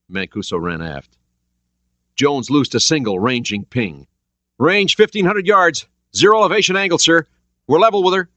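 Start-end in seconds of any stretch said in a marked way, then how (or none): 4.6-8.3 urgent sonar report. A narrator's reading. Jones reports range.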